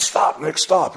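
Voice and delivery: yelling to halt the cart; urgent call to stop. Retold or imitated.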